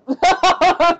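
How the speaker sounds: a loud burst of laughter. Real, not acted. Vlogger laughing at the plot twist, loud laugh.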